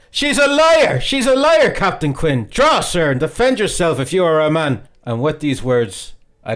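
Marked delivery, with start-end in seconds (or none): 0.1-4.9 high, loud furious shriek. A narrator's reading. Young Redmond challenges the captain, shrieking loud and high.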